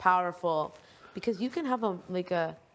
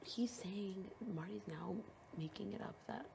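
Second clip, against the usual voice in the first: hushed gossip whisper; 14 dB quieter than usual. Real, not acted. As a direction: whisper it secretly. Gossiping about game moves.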